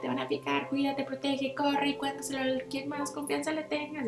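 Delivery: a hint of a panicky inner voice; alarmed nervous system. Her nervous system on alert, a hint of panicky warning. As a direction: sound the alarm, rushed and worried. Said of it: "sistema nervioso simpático en alerta".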